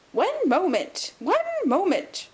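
put-on high, loud, drawn-out querulous old man; querulous cry. A narrator's reading. Old man interrupting from the doorway, querulous high cry. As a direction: cry out fussily, stretching the words. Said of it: "cried a querulous voice".

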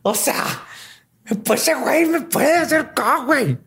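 put-on high panicky girl voice; panicked girl voice. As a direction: shriek it like a girl in sudden panic. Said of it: "Así que". (voz de chava asustada, muy aguda)

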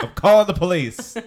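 very high, loud, laughing mock outrage; hammed-up horrified meme voice; Vine quote. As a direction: squeal the meme line. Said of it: "Remember that Vine?".

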